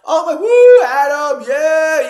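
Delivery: very high, loud imitation of cheers; cheering crowd. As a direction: whoop like a roomful of fans. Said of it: "cheering me on".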